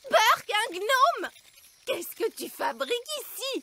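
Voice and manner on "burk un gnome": slow, loud, higher cry of disgust; a loud, slow yuck. Acted. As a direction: spit out the disgust at the gnome. Disgust at meeting gnome.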